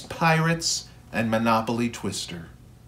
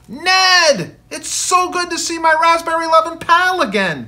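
much higher, louder delighted hello; joyful greeting; 8 dB louder than usual. Acted. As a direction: greet an old friend with a grin. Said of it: "Dad grinned".

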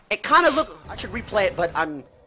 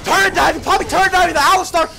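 raised, loud shoutcaster call; excited play-by-play; 8 semitones higher, 7 dB louder than usual. Real, not acted. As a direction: call the dive with urgency, voice pushed up. Calling the dive live.

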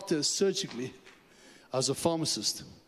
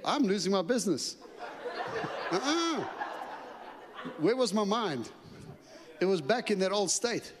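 higher, amused self-mockery; laughing excuse; 5 semitones higher than usual. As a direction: chuckle at your own old thinking. Retelling his excuse with laughter.

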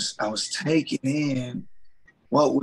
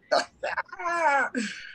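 high laugh; 13 semitones higher than usual. Real, not acted. Laughs at friend's words.

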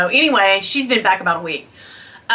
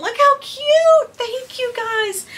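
cooing gush; 13 semitones higher, slower than usual. Real, not acted. Gushing over the scarf.

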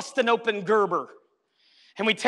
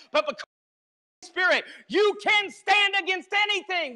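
high, shouted preacher's cry; preaching at full force; 8 semitones higher than usual. Acted. Preacher rousing the congregation about the Holy Spirit, shouting. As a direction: lift the whole room, shouting.

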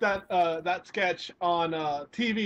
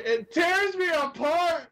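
higher, drawn-out anguished cry; melodramatic outburst; 7 semitones higher, slower than usual. Acted. Co-host hamming up being torn apart, melodramatic. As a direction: wail it like a tortured drama hero. Quoting film line dramatically.

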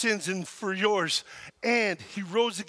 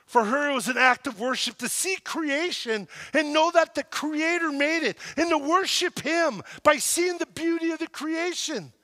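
ordinary delivery

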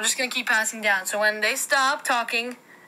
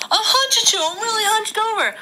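squeaky toy voice; 9 semitones higher, 5 dB louder than usual. Pretend. Voicing hunched toy character.

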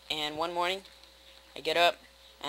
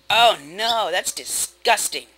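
disgust; 3 semitones higher, 8 dB louder, slower than usual. Real, not acted. Reacting to gravel.

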